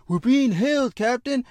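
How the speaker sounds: high-pitched put-on pilot voice; pilot calling out. Pilot alerting the captain, high acted call.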